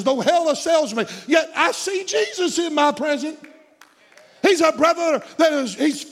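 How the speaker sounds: high, loud, building preaching; preaching at full force. Acted. Preacher rousing the congregation that Jesus is here, shouting. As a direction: rise to a shouted climax.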